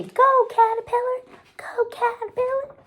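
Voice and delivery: high chanted cheer; high sing-song cheer for a child to copy. Shown as a demonstration. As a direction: cheer on the caterpillar like a fan.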